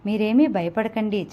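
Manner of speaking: usual speaking voice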